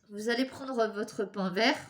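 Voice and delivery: ordinary delivery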